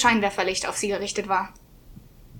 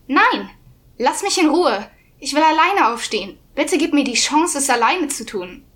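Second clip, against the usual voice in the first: raised, pleading cry; desperate protest; 3 semitones higher, 4 dB louder than usual. Acted. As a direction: beg to be left alone, loudly.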